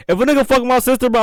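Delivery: emphatic and high; worked up. Real, not acted. Hypothetical about his sister, emphatic first take.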